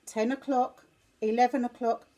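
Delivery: ordinary delivery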